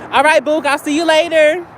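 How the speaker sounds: calling out goodbye. Real, not acted. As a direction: call a casual goodbye across to someone.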